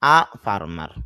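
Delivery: a said as a short uh before farmer; unstressed article a; weak a. Shown as a demonstration. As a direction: say আ farmer with a quick schwa a. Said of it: "এ না বলে আমরা বলবো আ".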